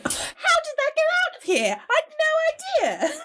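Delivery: high-pitched feigned surprise; mock innocence. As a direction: play dumb, airily high. Mock innocence about showy Oscar.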